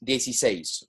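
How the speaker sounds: "dieciséis" run together as one word. Shown as a demonstration. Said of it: "It's quicker".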